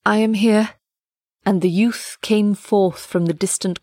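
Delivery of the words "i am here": trembling. Acted. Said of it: "in tones of trembling and apprehension".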